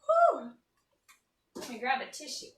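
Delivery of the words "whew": sharp whew. Real, not acted. Stung by strong onion.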